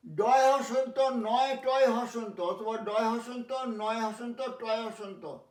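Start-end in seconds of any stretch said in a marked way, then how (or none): none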